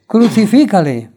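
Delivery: loud crowd cry to Pilate; crowd shouting. A narrator's reading. The crowd demands crucifixion from Pilate, loud shout. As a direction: call it out like an angry crowd. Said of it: "volvieron a dar voces".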